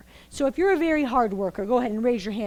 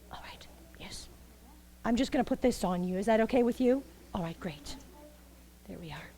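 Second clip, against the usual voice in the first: whisper; 3 semitones lower, 9 dB quieter than usual. Real, not acted. Fitting costume on volunteer.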